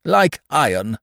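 high, loud, ringing answer; emphatic squire. A narrator's reading. Squire answering the captain's challenge, emphatic. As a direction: declare it with full resolve. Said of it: "answered the squire".

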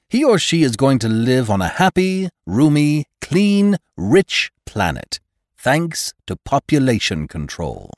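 high, loud, glowing promise; exalted doctor. A narrator's reading. Doctor promises the child a roomy planet, exaltedly. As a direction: ring out each adjective of the promised world.

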